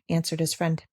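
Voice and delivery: usual speaking voice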